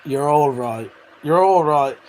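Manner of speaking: normal talking voice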